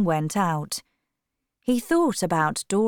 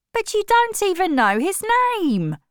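much higher, louder angry retort; a brother's angry challenge; said angrily; 13 semitones higher, 4 dB louder than usual. A narrator's reading. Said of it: "said her brother angrily".

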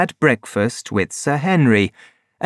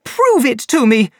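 high angry demand; high woman angrily demanding proof; 12 semitones higher, 3 dB louder than usual. A narrator's reading. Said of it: "cried Laura Lyons angrily".

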